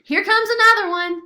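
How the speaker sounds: high, loud alarm; excited battle cry; warning shout. Acted. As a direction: shout the warning across the fight.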